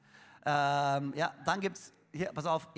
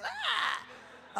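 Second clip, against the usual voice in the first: angeekelter Aufschrei. Retold or imitated. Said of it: "Ich denk mir".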